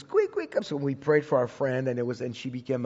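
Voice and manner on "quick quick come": high-pitched urgent call, retold. Husband calls his wife down from upstairs to pray, urgent high call. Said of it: "So I called her".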